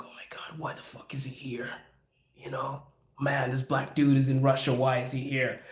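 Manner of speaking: imitated whisper behind someone's back; hushed muttering. Voicing immigrants muttering about him.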